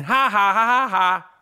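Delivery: deliberate, spaced-out fake laugh; mock gloating laugh. Bandwagon fan taunting Boston, fake gloating laugh. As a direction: taunt them with a staged ha-ha.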